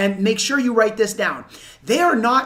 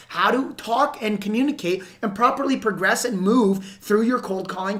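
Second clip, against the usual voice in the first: normal talking voice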